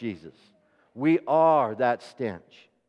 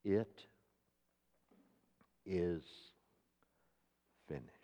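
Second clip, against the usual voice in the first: last words; 10 semitones lower, 17 dB quieter than usual. Acted. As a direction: breathe out the final words of a dying man. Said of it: "the last words he spoke".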